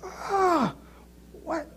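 high startled ah, then a clipped what; retelling his baffled reaction to his wife, high pitched; mock flustered ah. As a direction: caught off guard by her correction. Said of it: "And I was like".

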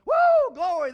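high, loud woo of glory; joyful shout. Real, not acted. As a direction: let faith burst out loud. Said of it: "that buoyed my faith up".

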